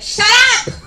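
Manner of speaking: high, loud mock yell; imitated exasperated shout; angry outburst. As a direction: snap loudly to silence the noise. Retold outburst at noisy people on set.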